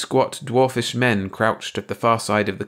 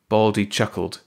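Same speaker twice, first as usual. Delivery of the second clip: plain reading voice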